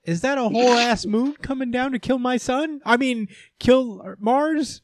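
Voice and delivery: high-pitched, overwrought wail; hammed-up tearful disbelief, far above your own voice; mock crying. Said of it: "He cries".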